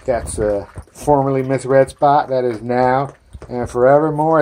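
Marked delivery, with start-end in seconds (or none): none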